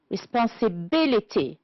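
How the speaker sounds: "Responsibility" with the fourth syllable stressed; stress on BIL. Shown as a demonstration. Teacher identifying stressed syllables, BIL stressed in "Responsibility". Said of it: "It's the B".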